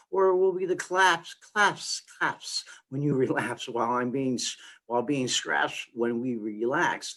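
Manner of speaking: rhyme-driven spoken word, punching the rhymes; poem recital. Acted. Poet performing a Mad Hatter rhyme for a listener, spoken-word recital.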